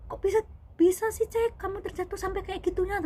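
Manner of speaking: very high, loud disbelief; shocked questioning. Retold or imitated. Father figure learning of her fall, shocked questioning. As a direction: imitate a startled father figure.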